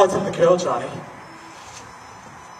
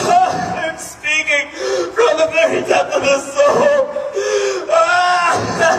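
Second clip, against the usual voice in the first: rising to a shouted, desperate plea; frantic pleading; 4 semitones higher than usual. Acted. Inmate begs doctor to jail him rather than keep him, frantic shouted pleading. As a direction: build from begging to an anguished cry. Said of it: "Calm yourself and explain".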